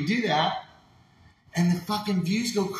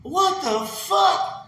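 much higher, very slow disbelief; stunned viewers; 14 semitones higher, 5 dB louder, much slower than usual. Retold or imitated. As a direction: stretch it out in baffled shock. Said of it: "every ten thousand people are going".

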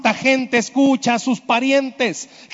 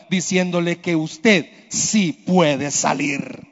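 ordinary talk

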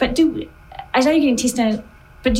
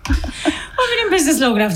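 laughing; 8 semitones higher than usual. Real, not acted. Laughing after teasing remark.

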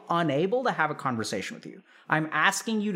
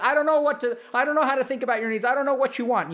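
helpless parent; 7 semitones higher, 4 dB louder, faster than usual. Acted. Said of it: "You're like".

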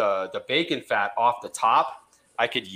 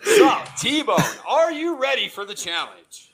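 game show host; 4 semitones higher, 5 dB louder than usual. Acted. Host hypes contestant.

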